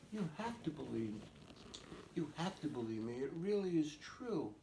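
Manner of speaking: put-on high, loud insistence of an upset girl; frustrated pleading. Frustrated little sister begging siblings to believe her, pleading. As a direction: plead to be believed, pressing each phrase. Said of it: "she was so frustrated".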